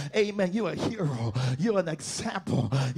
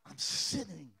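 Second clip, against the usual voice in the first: quiet preaching; 11 dB quieter than usual. Real, not acted. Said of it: "I'm preaching in here today".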